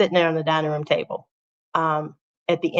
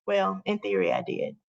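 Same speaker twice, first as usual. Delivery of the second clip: ordinary talk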